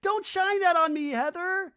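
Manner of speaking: loud, high mock scream; hammed-up frightened squeal; Brittany screaming. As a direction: shriek it in exaggerated panic. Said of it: "has Brittany screaming".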